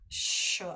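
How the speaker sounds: a strong voiceless sh; stronger sh. Shown as a demonstration. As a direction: hiss out a hard, strong sh. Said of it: "with the dot underneath is the s".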